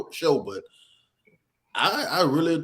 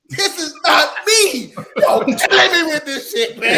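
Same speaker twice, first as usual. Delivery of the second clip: high, slow, louder mock protest; 11 semitones higher, 6 dB louder than usual. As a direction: ham up denying it's you. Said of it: "It's a character for the internet".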